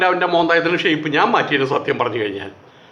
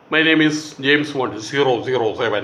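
James Bond style; 7 semitones lower than usual. Retold or imitated. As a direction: purr the name like a film spy. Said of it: "ആ സ്റ്റൈലിലൊക്കെ പറയും".

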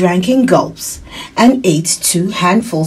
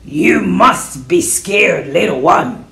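slow, put-on wolf voice; slower than usual. A narrator's reading. Wolf addressing a scared little one, slow creature voice. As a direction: speak slowly as the wolf, without softening. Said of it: "in a quiet voice".

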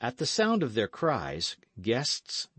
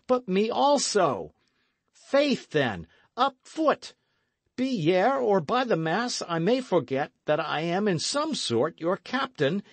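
higher, louder angry order; stern ringing command; 9 semitones higher, 4 dB louder than usual. Acted. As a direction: order the drunk out, rising to fury. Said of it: "the ring in Dick's voice".

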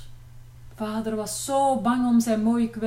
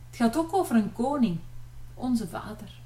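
ordinary delivery

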